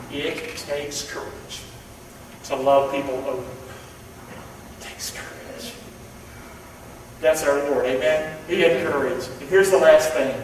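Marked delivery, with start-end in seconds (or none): 4.8-5.7 soft whispered point. Acted. Preacher hushes the key point in a whisper. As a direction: let it fall to a voiceless whisper.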